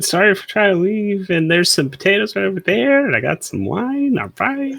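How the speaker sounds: high-pitched, offhand mock apology; passive apology. Marius half-apologizing to his guys over dinner, high and passive. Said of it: "Passively says something under his breath".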